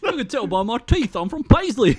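high-pitched mock Paisley accent. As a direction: lift the voice into a hammed-up Paisley accent. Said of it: "That Paisley accent there".

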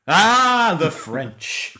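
put-on loud, slurred, belligerent old drunk; drunken belligerent Orson Welles. Old actor mocking a wine ad, loudly drunk and belligerent. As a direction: boom it out like a sozzled grand actor. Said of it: "getting drunker and more belligerent".

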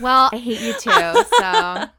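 loud laughing comeback. Real, not acted. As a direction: laugh through the retort. Laughing teasing retort.

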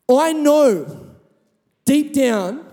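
impassioned preaching. Acted. Pastor urging the congregation to keep faith, impassioned preaching.

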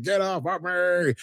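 high, slow mock cry; hammed-up panicked plea. Picard pleading with the Borg touching him, high slow mock panic.

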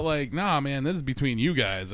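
usual speaking voice

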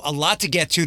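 ordinary delivery

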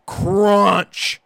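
imitated nasty crunch. Driver imitates the van hitting the stump, nasty crunch. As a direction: make the word sound like the impact. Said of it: "This nastiest sounding crunch sound".

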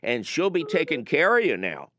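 sing-song Upper Midwest clerk, lightly done; mock Minnesota accent, cheerful and chatty. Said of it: "And I swear to God, no exaggeration".

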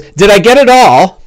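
a prim fellow worried about his face; Bing fussing. Acted. GM voices Bing.

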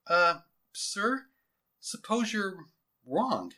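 tentative, slow and high; hesitant. Acted. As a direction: timidly question a superior, voice lifting. Underling timidly doubts his general.

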